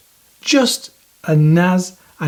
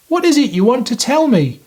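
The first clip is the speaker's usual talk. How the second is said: Magic Mouse voice; 9 semitones higher, faster than usual. Acted. Magic Mouse speaks to Sid.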